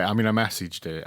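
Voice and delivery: ordinary talk, nothing marked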